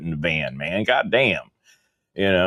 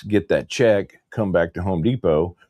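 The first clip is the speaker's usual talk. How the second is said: usual speaking voice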